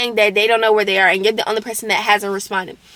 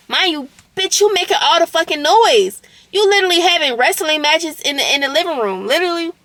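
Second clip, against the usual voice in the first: exasperated rant; 6 semitones higher than usual. Real, not acted. Ranting about noisy roommate.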